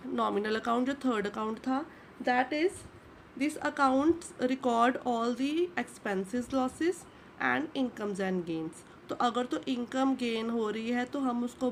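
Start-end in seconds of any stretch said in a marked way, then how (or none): 2.2-8.8 very slow, word by word, as if for notes; slow dictation. Shown as a demonstration. Teacher dictating a definition to students, very slow. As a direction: spell it out slowly so students can write.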